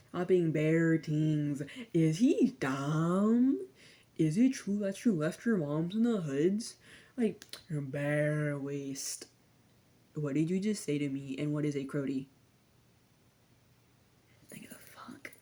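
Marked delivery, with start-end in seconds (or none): 0.1-9.3 loud, slow Toronto slang in a Jamaican lilt; hammed-up Toronto accent with Jamaican sounds; mock Toronto accent. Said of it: "it's literally just a Jamaican accent". 14.5-15.3 breathy under-the-breath whisper; whispered aside. Real, not acted. Aside reaction to slang.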